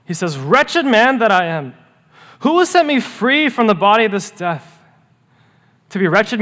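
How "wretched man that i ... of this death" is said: raised, anguished cry, higher and louder; an anguished cry to heaven; holy frustration cry. Retold or imitated. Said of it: "Paul cries out with a holy frustration".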